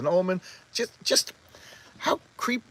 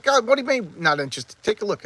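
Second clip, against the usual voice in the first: high-pitched mock disbelief; incredulous insistence; 7 semitones higher, 4 dB louder than usual. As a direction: act out pushy, baffled protest. Acted disbelief at the refusal.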